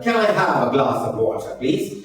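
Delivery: slow, demonstrated British accent; British-style, unhurried polite question; British English. Shown as a demonstration. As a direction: put on a measured British English request. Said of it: "ये British English है".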